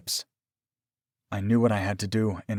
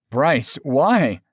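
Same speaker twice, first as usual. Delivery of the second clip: raised, anguished appeal, more cry than whimper; 5 semitones higher, 6 dB louder than usual. A narrator's reading. Aaron betrayed by his lover, whimpered. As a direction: wail the question, hurt and pleading. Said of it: "Aaron whimpered".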